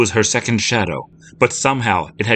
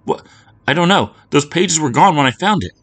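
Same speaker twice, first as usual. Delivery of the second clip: much higher, louder panicked denial; terrified girl; 9 semitones higher, 5 dB louder than usual. Acted. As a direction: protest desperately. Lucy terrified under accusation.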